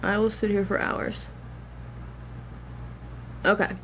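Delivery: talking while chewing gum. Real, not acted. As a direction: speak around a wad of gum. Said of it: "I'm sorry, I'm chewing gum".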